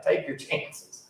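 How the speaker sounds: hushed, slow tongue-in-cheek advice; mock advice. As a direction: whisper it as a joke you take back. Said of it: "I'm kidding".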